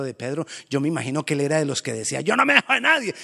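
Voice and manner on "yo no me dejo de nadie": high, loud bragging; boastful Peter. Acted. Peter bragging to everyone, loud high boast. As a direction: puff up like a tough guy.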